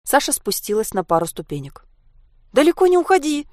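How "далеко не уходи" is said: high, loud worried plea; anxious call. A narrator's reading. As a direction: call out anxiously after a friend. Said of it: "заволновалась Анька". (взволнованный высокий оклик)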